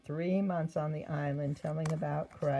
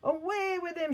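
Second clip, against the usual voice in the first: crowd shouting; 12 semitones higher than usual. Acted. Crowd demanding Paul be taken, shouting. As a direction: shout him away. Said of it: "shouted the crowd".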